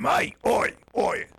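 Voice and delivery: cheeky English shout-out like Roy Kent; mock Roy Kent. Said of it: "we go".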